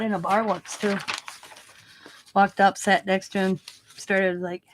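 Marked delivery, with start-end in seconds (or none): none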